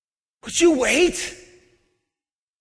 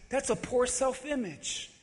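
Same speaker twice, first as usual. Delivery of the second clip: usual speaking voice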